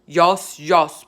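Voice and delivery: loud mocking boom. A narrator's reading. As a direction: boom it back at him. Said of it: "boomed the blood bottler".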